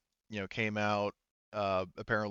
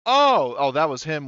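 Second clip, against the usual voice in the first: loud, high "oh" of dawning understanding; surprised, pitched-up exclamation; sudden realization; 6 semitones higher, 11 dB louder than usual. Real, not acted. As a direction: it just clicked, blurt it out louder. Sudden realization of who it was.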